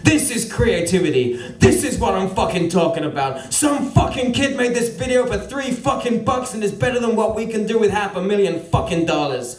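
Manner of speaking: lightly voiced American executive, jabbing each point home; a hint of the American boss driving his point home; emphatic American boss. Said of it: "to underline his emphasis".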